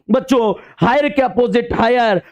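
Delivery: normal talking voice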